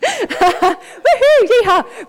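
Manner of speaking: a high laughing woohoo, yeehaw; laughing country whoop. Pretend. Preacher mocking a country town with a whoop.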